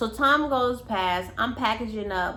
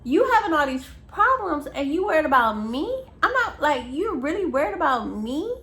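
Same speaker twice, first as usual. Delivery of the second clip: exasperated disbelief; 6 semitones higher, 4 dB louder than usual. Retold or imitated. Her own retold exasperated reply.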